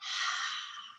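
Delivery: long unvoiced H exhale, like fogging a mirror; breathy H exhale onto a mirror; whispered H breath. Shown as a demonstration. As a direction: breathe out a soft whispered haaa. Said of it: "if you had a mirror and you wanted to fog it up".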